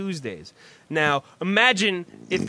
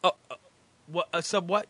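usual speaking voice

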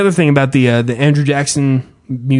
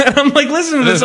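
laughing; 10 semitones higher, much faster than usual. Real, not acted.

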